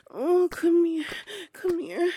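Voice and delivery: eager call. Acted. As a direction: pull him in with a hungry call. Beckoning her partner closer.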